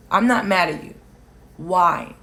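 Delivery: ordinary talk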